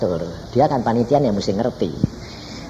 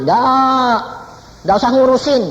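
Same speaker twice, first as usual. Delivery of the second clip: put-on high, louder grumbling old father; stubborn father; 12 semitones higher, 4 dB louder, slower than usual. Grieving father refusing his son, high and louder. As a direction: brush him off like a stubborn old man. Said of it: "ngomong sama papa gini".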